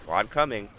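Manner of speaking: speaking through hiccups. Real, not acted. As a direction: hiccup while calling it. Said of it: "The hiccups has plagued me again".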